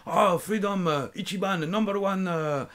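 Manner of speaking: high, hyped-up mock Japanese English; strong Japanese accent. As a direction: pitch it excitedly with a Japanese accent. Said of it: "avec un fort accent japonais".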